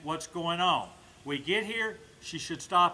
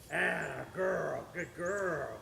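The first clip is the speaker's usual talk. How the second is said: gently reward the dog, drawing it out; praising the dog for loading up; soft slow praise; 3 semitones lower, 5 dB quieter, much slower than usual. Real, not acted. Praising dog after load up.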